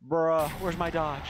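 exasperated drawl. Real, not acted. Frustrated at missing dodge.

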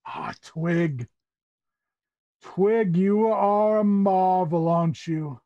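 slow, drawn-out theatrical admiration; admiring Tabaxi uncle. Acted. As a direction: swell with pride over the guest's name. Tabaxi host admires his guest.

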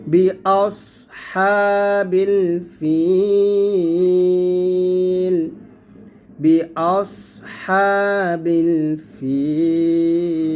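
হা pressed from mid-throat, thin and light. Shown as a demonstration. Teacher models হা for students, pressed from the throat. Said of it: "হার উচ্চারণটা গলার মাঝখান থেকে চাপ দিয়ে চিকন করে বলবেন".

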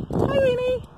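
very high little hello to a dog; high-pitched doggy talk; pet greeting. Real, not acted.

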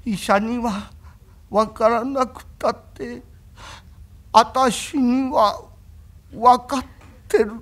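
a hint of a weak, slow invalid; barely able to speak, lightly voiced; faint invalid voice. Sick young master tells Kuma he knows, faint and slow.

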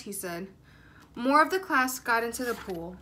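usual speaking voice

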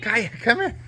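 high-pitched pet call; calling the puppy. Real, not acted.

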